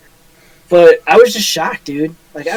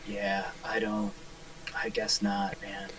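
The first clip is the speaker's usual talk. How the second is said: low, quiet bored monotone; 8 semitones lower, 21 dB quieter, slower than usual. Retold or imitated. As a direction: mumble it like an uninterested clerk. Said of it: "the most monotone voice".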